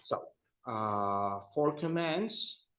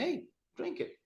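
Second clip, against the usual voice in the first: urging a friend; 13 semitones higher, 8 dB quieter, faster than usual. Pretend. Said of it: "you're telling a person".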